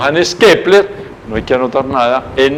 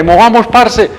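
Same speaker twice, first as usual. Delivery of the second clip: cheerful call; 7 semitones higher, 4 dB louder, slower than usual. Retold or imitated. Friendly call to go.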